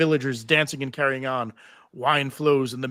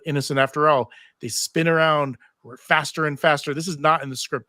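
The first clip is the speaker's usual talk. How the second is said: normal talking voice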